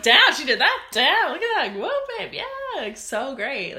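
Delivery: high, excited gushing over nails; gushing excitement. Retold or imitated. Friend gushing over nail photos, high and excited. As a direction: squeal with delight at her photos.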